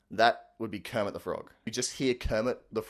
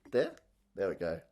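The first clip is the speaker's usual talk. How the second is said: usual speaking voice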